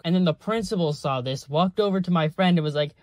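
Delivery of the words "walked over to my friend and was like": ordinary delivery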